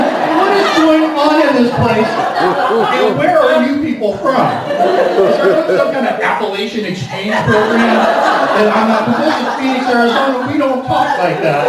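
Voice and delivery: mock outrage at the strange locals; incredulous rant. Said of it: "exasperated rant".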